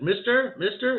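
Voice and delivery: high calling out after the man. Retold or imitated. Boy calling after the vanished man, high call.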